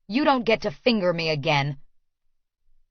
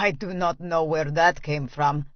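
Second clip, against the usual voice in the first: amused Russian man; 4 semitones lower than usual. Acted. Amused after barking laugh.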